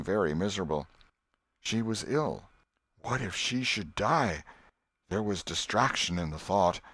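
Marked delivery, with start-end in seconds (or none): none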